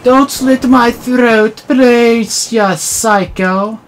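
high, loud hammed-up begging for mercy; loud mock panic, pleading the killer to spare him; mock terrified pleading. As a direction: beg for your life, over the top. Pleading with enemy attacker.

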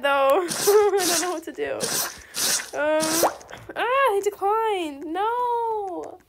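normal talking voice

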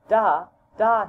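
put-on child calling da loudly. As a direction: call for your father.

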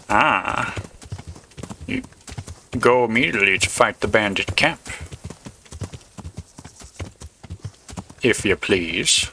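a hint of a Boston accent, slowing on the last words. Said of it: "giving him, like, a Boston accent".

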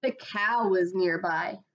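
usual speaking voice

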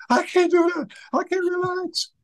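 very high panicked squeal; freaking out. Retold or imitated. Nephew answers uncle's plea to relax, mock hysterical. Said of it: "he's freaking out".

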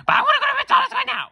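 put-on very high, loud child voice demanding; child's tantrum. Little boy shrieking at his parents in the mall.

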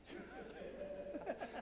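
a short high burst of laughter. Real, not acted. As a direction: laugh along at the joke. Laughs at the impatient joke.

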